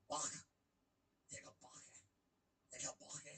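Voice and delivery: mock Scouse, pitched low; Scouse accent. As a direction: imitate a Liverpool Scouse accent. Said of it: "I like the Scouse accent".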